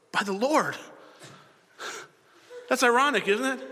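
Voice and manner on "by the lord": higher-pitched mock oath; sworn oath. Saul swearing to the witch, emphatic oath. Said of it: "Saul swore to her".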